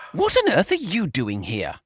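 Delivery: a touch of outrage, voiced as Brian; outraged demand. A narrator's reading. Brian confronts brother George, mild outrage. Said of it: "Brian demanded in outrage".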